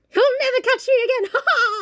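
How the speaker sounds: high, cackling villain gloat; hammed-up cartoon villain with evil laugh; Disney villain. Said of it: "Disney villain".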